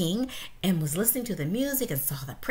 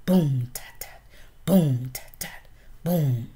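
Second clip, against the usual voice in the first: vocal drumbeat; 5 semitones lower, 4 dB louder than usual. Acted. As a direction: beat the drum with your voice. Drum rhythm voiced.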